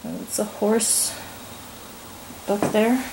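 ordinary talk, nothing marked

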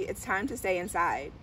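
ordinary delivery, nothing marked

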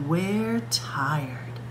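ordinary delivery

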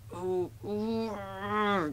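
high, drawn-out groan; long strained groan. A narrator's reading. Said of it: "groaned Thomas".

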